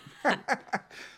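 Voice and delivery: short, high-pitched laugh. Real, not acted. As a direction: laugh at your own admission. Laughs at own mispronunciation admission.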